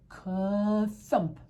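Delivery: drawn-out "ka", then a punched "thump"; storybook thud sound effect; imitated thump. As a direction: act out a heavy bag landing. Imitates bag of candy thumping.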